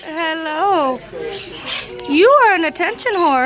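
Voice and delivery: ordinary delivery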